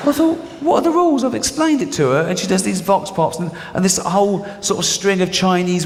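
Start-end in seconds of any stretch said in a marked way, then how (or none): 0.1-2.2 high indignant squawk; exasperated. Retold or imitated. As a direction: throw up your hands in disbelief. Exasperated outburst at the paper.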